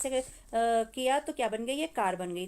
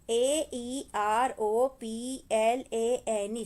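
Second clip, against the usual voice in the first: each letter spelled out slowly and drawn out; slow spelling; 3 dB louder, much slower than usual. Shown as a demonstration. Teacher spelling aeroplane slowly for the children.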